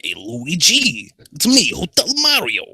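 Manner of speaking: high, stretched Italian cartoon plumber; Hotel Mario. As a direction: call out loud and cartoonishly, rising high. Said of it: "who wants Tails to be Hotel Mario?".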